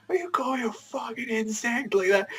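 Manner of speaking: high-pitched mock outrage; losing it. Asta answering back over the insect insult, losing it. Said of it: "He just loses it".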